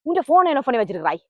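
ordinary delivery

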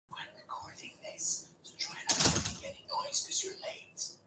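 hushed whisper to a latecomer. Real, not acted. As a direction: whisper a discreet warning. Whispered warning to a latecomer while recording.

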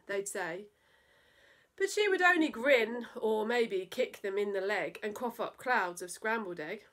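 put-on lively rhyming read-aloud for children; playful children's story narration; storytelling voice. A narrator's reading. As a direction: bounce through the verse like a bedtime poem. Reads a silly poem to children.

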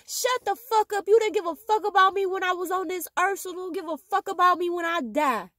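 yelling at people who never cared; angry retort. Acted. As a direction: snap back at the fake mourners. She lashes out at fake mourners.

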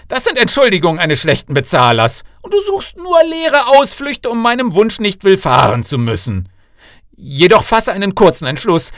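loud, high scolding tirade; father laying down the law in a temper; angry king. Acted. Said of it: "Trotz dieser zornigen Rede".

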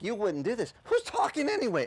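high, startled questioning; rising in disbelief; incredulous inner reply. Retold or imitated. Young fighter answering the voice, high and startled. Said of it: "I was like".

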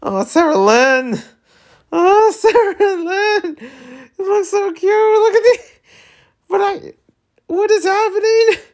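very high, squealing tearful coo; overcome, tearful gushing. Real, not acted. Reader overcome by cute flashback scene.

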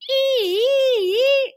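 very high, loud wail; piercing sustained siren squeal; shrieking siren. A narrator's reading. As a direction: wail like a fire engine siren. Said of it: "shrieked the siren".

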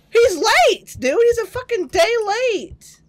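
high, loud disbelieving complaint; exasperated outburst. Real, not acted. Player complaining about the late priest, exasperated outburst.

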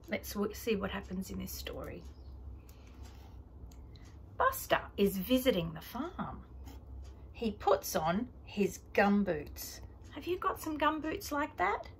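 gentle storytime voice for little ones; reading to a toddler. Acted. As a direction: read it like a picture book. Reading picture book to toddler.

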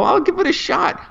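very high-pitched retold reply; acted willingness. Patient agreeing to try Ritalin, mock high voice. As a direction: pitch it way up, like a comic squeak. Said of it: "and I go".

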